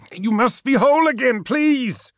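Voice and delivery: high, loud begging; urgent, rising entreaty; desperate plea. Acted. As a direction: cry out to a father. Ammon begs his father.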